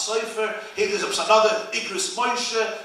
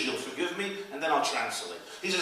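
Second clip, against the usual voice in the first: usual speaking voice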